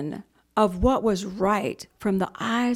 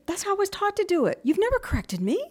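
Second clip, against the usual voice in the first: indignant protest; 11 semitones higher than usual. Retold or imitated. Acted argument with the manager.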